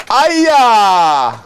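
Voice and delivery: long loud high yell; triumphant cheer. Real, not acted. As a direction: let out a victory whoop. Cheer after wicket.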